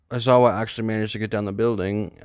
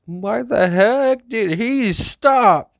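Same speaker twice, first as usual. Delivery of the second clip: much higher, slow and louder, baffled; confused outburst; 12 semitones higher, 6 dB louder, much slower than usual. Acted. Izuku seeing the zombie freeze, high baffled outburst. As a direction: demand an answer in disbelief.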